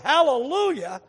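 loud hallelujah shout from the crowd; retold worshipper's holler; imitated yelling. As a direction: cry out in mock-ecstatic praise. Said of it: "What are you doing here yelling".

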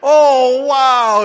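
very high, loud astonished gasp; shocked and awed. Retold or imitated. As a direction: act out the brother hearing about the middle school mascot. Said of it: "shocked and awed".

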